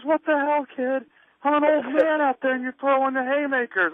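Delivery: hammed-up outrage at being hit too hard; mock angry complaint. Old veteran scolding the kid about his haymakers, high mock anger. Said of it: "he goes".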